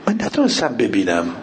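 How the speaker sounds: ordinary talk, nothing marked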